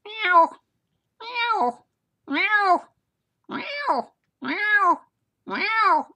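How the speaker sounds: very high-pitched meows, one by one; cat meowing. Retold or imitated. Husband imitating the cat heard from bed, high meowing. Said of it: "and I heard".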